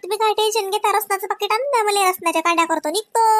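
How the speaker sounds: high, mock-sassy retort; wife retelling her cheeky reply to her husband. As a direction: toss the husband's idea back at him. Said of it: "म्या म्हणलं".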